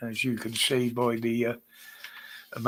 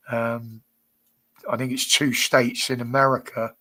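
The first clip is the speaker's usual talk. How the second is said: normal talking voice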